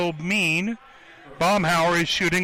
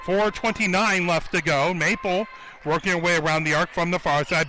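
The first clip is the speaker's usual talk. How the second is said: ordinary delivery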